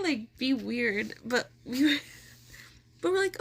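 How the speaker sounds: ordinary talk, nothing marked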